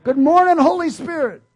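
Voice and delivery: high, loud, bright morning call; joyful greeting. Shown as a demonstration. Preacher shows joyfully greeting the Holy Spirit, high and loud. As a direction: greet the Spirit like a thrilled friend. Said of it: "you should invite the Holy Spirit".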